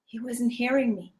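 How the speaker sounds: ordinary talk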